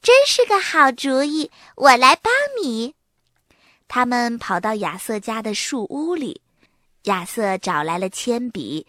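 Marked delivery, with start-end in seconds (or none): 0.0-2.9 delighted, higher and louder. A narrator's reading. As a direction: jump at the idea happily. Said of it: "高兴地直拍手说". (高兴地提高嗓门赞成)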